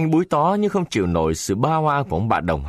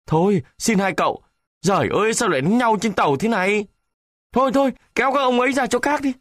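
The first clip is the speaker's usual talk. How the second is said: much higher, frantic pleading; pleading bystander; 12 semitones higher, faster than usual. A narrator's reading. Passengers pleading with the two fighters, much higher and frantic. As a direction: beg them to stop fighting.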